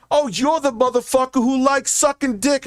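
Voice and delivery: channel the scammer as a movie badass; Samuel L. Jackson voice. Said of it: "he sounded exactly like Samuel L. Jackson".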